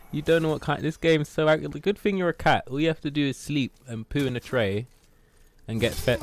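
ordinary delivery

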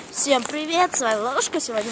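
ordinary talk

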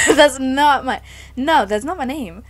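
loud, burst out laughing while protesting; laughing disbelief. Real, not acted. Laughing at being called Olivia.

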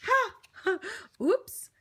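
plain reading voice

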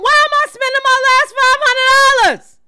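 high, loud disbelief; incredulous outburst. Real, not acted. Ranting at viewers about spending his last dollars, incredulous and loud. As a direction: cry out at the price, stunned.

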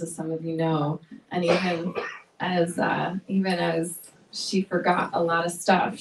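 ordinary talk, nothing marked

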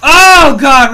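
high, loud cry easing down; pained yell. Real, not acted. Player nearly killed by a hit, pained yell. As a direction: yell out, then come down gradually.

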